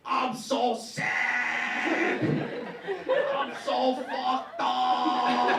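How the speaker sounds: slow, whiny hammed-up sobbing impression; mock crying. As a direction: ham up a blubbering crying actor. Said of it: "he's, like, crying".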